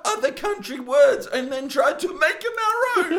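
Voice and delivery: high laughing complaint. Real, not acted. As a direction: accuse through giggles. Laughing accusation.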